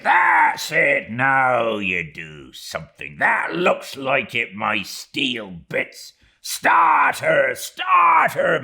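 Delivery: high, loud, half-joking bellow at the oarsmen; mock-furious boat-header shouting pull; Stubb rallying his rowers. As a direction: roar them on with fury spiced with fun. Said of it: "a tone so strangely compounded of fun and fury".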